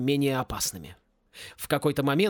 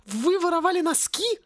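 very high, appalled question; disgusted; 13 semitones higher than usual. A narrator's reading. Woman appalled at stolen socks, high disgusted voice. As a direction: squeal it in disgust. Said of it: "с отвращением проговорила мисс Сьюзан".

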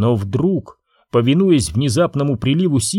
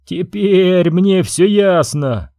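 slow, higher mock outburst; furious cry; 6 semitones higher, much slower than usual. Prince turning on the queen and Jedi, higher slow angry cry. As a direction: shout it in fury, drawn out. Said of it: "в ярости вскричал".

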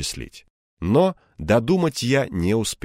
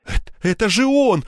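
high, stammering outrage; outraged, raised-pitch tirade; breathless indignation; 13 semitones higher, 4 dB louder than usual. A narrator's reading. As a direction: splutter the accusation, short of breath. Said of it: "в приливе возмущения ему трудно было дышать".

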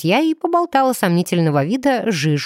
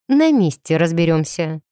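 ordinary delivery, nothing marked

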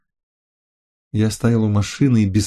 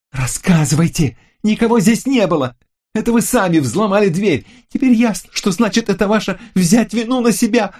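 furious and menacing; 12 semitones higher than usual. A narrator's reading. Lucille accuses the narrator of breaking the door, furious shout. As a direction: spit it out, furious and ominous. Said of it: "Голос звучал яростно и зловеще".

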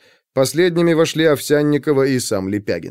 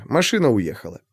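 usual speaking voice

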